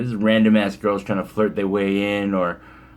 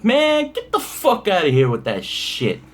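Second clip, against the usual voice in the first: high-pitched, mock-outraged brush-off; acting out a rude, no-remorse rejection; dismissive dick; 10 semitones higher than usual. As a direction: tell him off like a total jerk, pitch way up. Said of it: "I was a fucking dick".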